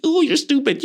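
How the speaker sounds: high-pitched silly tease; quirky, silly. Acted. Playing around to lighten the mood, quirky and silly. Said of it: "the quirky, silly".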